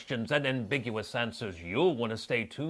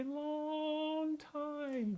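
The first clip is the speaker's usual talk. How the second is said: very slow, much higher drawn-out phrase; 13 semitones higher, 4 dB quieter than usual. Acted. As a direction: stretch it out for emphasis. Stretched for emphasis.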